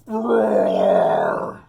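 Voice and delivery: big loud growling roar; tiger roar. Acted. Reading the tiger's roar to the kids, full loud roar. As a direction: roar like the loudest tiger in the jungle.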